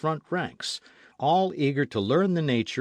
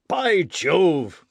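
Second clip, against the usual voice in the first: indignant exclamation, louder and higher; old gunner crying out, louder; cried out; 6 semitones higher, 8 dB louder than usual. Acted. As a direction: burst out in offended anger. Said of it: "cried J T Maston".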